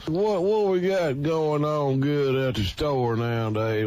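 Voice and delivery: booming hillbilly pitchman hollering to the crowd; loud ad pitch. Acted. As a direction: sell it loud and proud like a TV huckster. Loud hyped ad intro.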